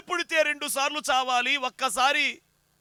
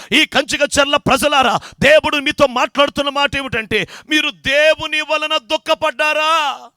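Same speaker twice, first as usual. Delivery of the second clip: loud, driving sermon call; fiery preaching; 6 dB louder than usual. Acted. Preacher addresses the Kanchikacherla people in fiery preaching. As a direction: call out to the colony people, louder and louder.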